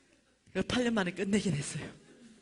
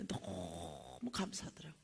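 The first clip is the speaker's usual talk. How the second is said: slow, low whisper; heartfelt gratitude; 14 semitones lower, 11 dB quieter, much slower than usual. Real, not acted. Preacher recalling gratitude at home that night, whispered. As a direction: breathe the thanks out, deeply moved.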